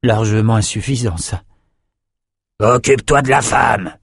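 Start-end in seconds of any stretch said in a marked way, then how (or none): 2.6-3.9 growled. A narrator's reading. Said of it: "grogna Calvert".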